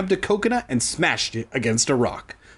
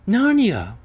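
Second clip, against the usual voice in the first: amazed; 9 semitones higher, 4 dB louder than usual. A narrator's reading. Gasp at a silvery world.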